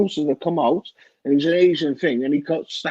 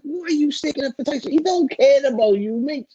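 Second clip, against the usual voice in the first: much higher, mocking the fighter's defender; mocking quote; 10 semitones higher than usual. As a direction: toss it off as a lightly voiced jab at a Fury fan. Mocks fighter defending Tyson Fury.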